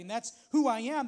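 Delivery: ordinary talk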